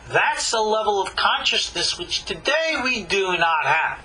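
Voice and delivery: usual speaking voice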